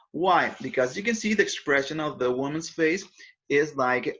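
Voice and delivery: ordinary talk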